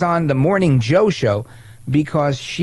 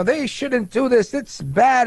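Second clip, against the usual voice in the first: higher, slow, pouting scold; mock whine; 8 semitones higher, much slower than usual. Host mocks AOC's complaints about Democrats, light pouting whine. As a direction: mimic a sulky complaint. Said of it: "hear her complain and whine".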